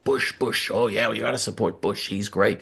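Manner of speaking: mock the loyal Bush fans, softly; sarcastic mimicry. Host mocking Republicans who backed Bush, lightly sarcastic.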